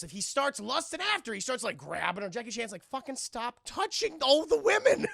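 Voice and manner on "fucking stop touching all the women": high, loud reprimand; hammed-up outraged order; mock exasperated scolding. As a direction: yell at a misbehaving partner. Voices Jackie scolding Tucker.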